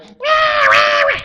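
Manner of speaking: high, loud imitated baby wail; mock baby crying. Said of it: "Sometimes they're like".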